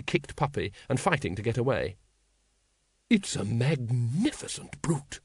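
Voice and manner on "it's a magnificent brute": loud whisper. Acted. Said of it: "in what she probably thought was a whisper".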